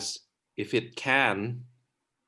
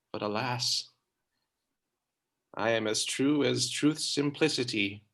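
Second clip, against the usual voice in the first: sincere; 3 semitones lower, 5 dB quieter than usual. Acted. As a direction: speak it from the heart. Troilus sincere vow.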